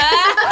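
sudden high laugh; burst of laughter. Real, not acted. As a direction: laugh out loud, caught off guard. Laughing at friend's joke.

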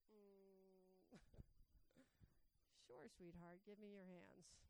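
calm her down gently, like a nurse; soothing sweetheart. Retold or imitated. Pastor gently soothing a nervous woman at church, hushed and tender.

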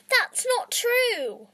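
high, loud, slow protest; shrill cry. Acted. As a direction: cry it out across the theatre. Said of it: "a shrill cry echoed".

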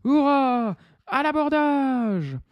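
high drawn-out battle cry; pirates' cheer. Acted. Pirates cheering as they board, high battle cry. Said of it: "poussent des hurlements".

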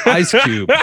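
laughing through the words. Real, not acted. Comedian cracking up at own story, laughing. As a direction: giggle out the punchline.

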